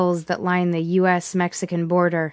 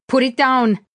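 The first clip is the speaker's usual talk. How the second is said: higher-pitched urgent yell; 6 semitones higher, 4 dB louder than usual. A narrator's reading. Melina yelling during the struggle over the gun, yelled. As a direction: yell it as a desperate order. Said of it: "Melina yelled".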